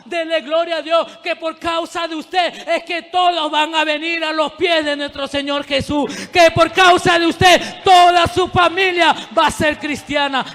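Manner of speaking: preacher at full cry; shouted preaching. Real, not acted. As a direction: shout the promise to the church.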